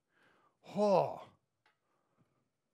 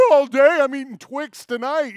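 high, loud giddy laugh then whoop; gleeful; 8 semitones higher, 12 dB louder than usual. Retold or imitated. Boy thrilled with a twenty dollar tip, laughing loudly. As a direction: cackle with kid's delight. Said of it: "I was like".

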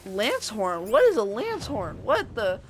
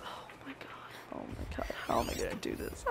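whisper; 15 dB quieter than usual. Real, not acted. Sneaking past watchers.

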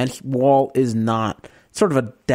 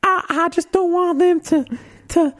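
camp it up as a fretful Southern wife; mock Southern belle. [name] playing Skyler opposite Walt, high mock Southern voice. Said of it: "you be Skyler and I'll be Walt".

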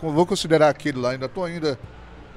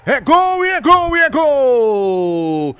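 slow stretched goal chant; goal shout; 6 semitones higher, 3 dB louder, much slower than usual. Real, not acted. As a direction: drag out every gol. Goal call in live commentary.

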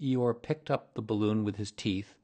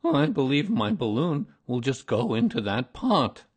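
loud, higher, lively delivery; excited Eeyore; 4 semitones higher, 8 dB louder than usual. A narrator's reading. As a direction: glum donkey perking up. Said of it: "he became quite excited".

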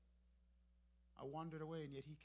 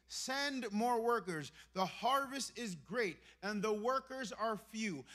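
slow emphatic scripture. Real, not acted. Quoting Jesus, slowing.

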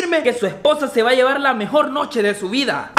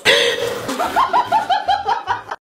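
high laugh. Acted. Laugh after raffle joke.

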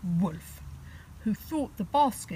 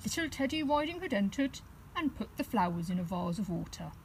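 plain reading voice

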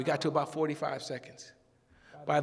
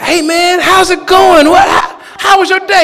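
high, loud overeager greeting; forced cheery shout; 13 semitones higher, 20 dB louder than usual. Retold or imitated. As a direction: shout a fake-happy hello way up high.

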